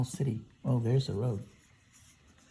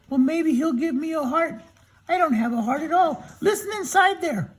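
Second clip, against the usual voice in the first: high, relieved Tin Man; storyteller voicing a high grateful Tin Man; 14 semitones higher, 4 dB louder than usual. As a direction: pitch up as the freed Tin Man. Tin Man without a heart.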